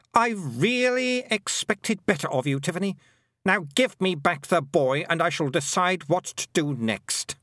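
high, commanding queen's voice; scold, then give a haughty order; imperious Queen. A narrator's reading.

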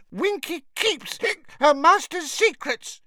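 high hiccuping elf, stubborn and defiant; defiant drunken squeak; mutinous. A narrator's reading. Drunk Winky refuses Harry, mutinous. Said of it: "she said mutinously".

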